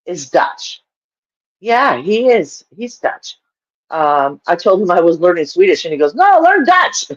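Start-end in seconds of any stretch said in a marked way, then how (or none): 6.1-7.1 high-pitched mock insistence; insistent retort. DJ friend correcting her language choice, mock-insistent. Retells DJ's insistent reply.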